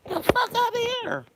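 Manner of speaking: very high-pitched disbelief; high, incredulous outburst; incredulous dismissal. Real, not acted. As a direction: squeal it out in disbelief. Incredulous at blaming the woman.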